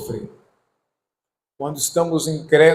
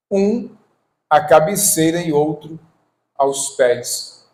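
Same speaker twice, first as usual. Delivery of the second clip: scripture reading; 5 semitones higher, 7 dB louder than usual. Acted. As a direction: proclaim the verse to the congregation, phrase by phrase. Reads scripture aloud to congregation.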